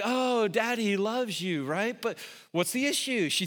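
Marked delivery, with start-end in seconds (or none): 0.0-1.6 comforting dad. Retold or imitated. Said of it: "I'm like".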